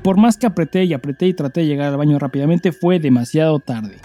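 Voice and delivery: steady storytelling read with some drama; story reading. Acted. Host reading a listener's story aloud, storytelling voice. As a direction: read it like a confession being told to listeners.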